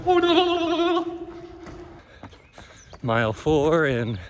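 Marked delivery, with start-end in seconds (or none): 0.1-1.2 very high hammed-up squeal; mock fright. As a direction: play at being terrified. Playful squeal in tunnel.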